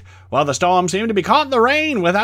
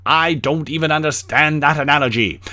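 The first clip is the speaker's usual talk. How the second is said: ordinary talk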